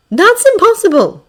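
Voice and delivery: high, loud disbelief; shaken. A narrator's reading. Yaoyorozu reacts to news of stolen quirks, shaken. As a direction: protest in alarmed disbelief. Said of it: "Yaoyorozu sounds shaken".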